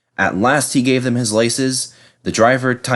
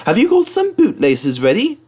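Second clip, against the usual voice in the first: little tank engine taunting James, high and cheeky; cheeky teasing; 4 semitones higher than usual. Acted. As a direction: tease him about the bootlaces. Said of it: "he ran off laughing rudely".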